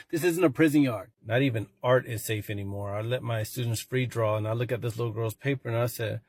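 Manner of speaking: ordinary delivery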